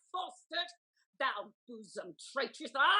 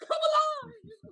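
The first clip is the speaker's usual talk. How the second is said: much higher, slow, crying shout; sobbing cry; 10 semitones higher, slower than usual. Acted. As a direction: wail the plea through sobs. Lady begging him come back alive.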